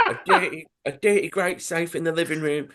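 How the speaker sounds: high, hammed-up London crook; comic London villain accent, pitched well up. Chap resumes his burglary report, in an overdone London accent. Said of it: "so he says".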